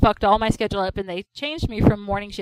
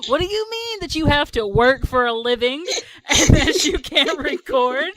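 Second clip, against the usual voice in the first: mildly indignant higher-pitched retort; mock anger; 4 semitones higher, 3 dB louder than usual. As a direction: imitate an angry chat rant, half amused. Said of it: "angry at each other in the chat".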